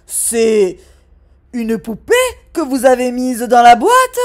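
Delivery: barely contain the anger; a red-faced father, loud; angry dad. Acted.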